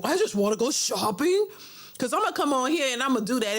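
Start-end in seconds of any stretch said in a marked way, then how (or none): 0.0-1.5 put-on croaky old-lady whine; mocking old woman voice. Mocking an aging diva's shopping plea, croaky. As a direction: talk like there's a frog in your throat. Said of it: "like a frog in my throat".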